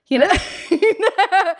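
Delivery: can't stop giggling at the memory; laughing. Real, not acted. Laughing at own tennis story.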